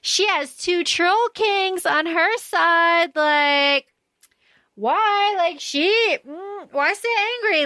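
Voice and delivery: high, drawn-out imitation of loud gushing; imitating loud character. Podcaster quotes Randolin praising the queen, mock loud. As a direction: play loud by stretching it out high, without real volume. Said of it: "Randolin being so loud".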